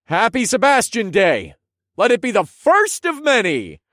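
loud, high festive call; rally the townsfolk with cheer; loud toast. A narrator's reading. Hero toasts the crowd.

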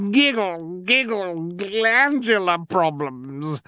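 high affected giggling; giggling cry. A narrator's reading. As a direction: trill it out like a vain diva. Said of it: "Esme cried".

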